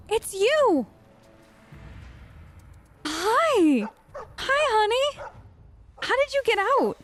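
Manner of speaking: high-pitched, gushing dog greeting; delighted greeting. Real, not acted. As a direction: squeal hello to a beloved dog.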